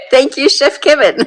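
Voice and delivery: loud, laughing thank you. Real, not acted. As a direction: cheer him through laughter.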